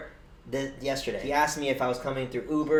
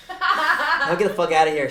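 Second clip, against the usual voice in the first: laughing retort; 5 semitones higher, 8 dB louder than usual. Retold or imitated.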